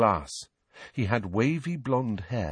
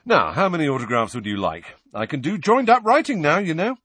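higher, loud, eager boast; vain celebrity; 7 semitones higher, 4 dB louder than usual. A narrator's reading. Memory-damaged teacher offering autographs, eagerly boastful. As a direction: show off like a proud child. Lockhart boasts childishly of writing.

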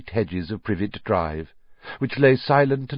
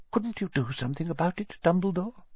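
higher McGonagall voice; 9 semitones higher, 6 dB quieter than usual. A narrator's reading.

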